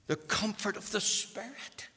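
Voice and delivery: normal talking voice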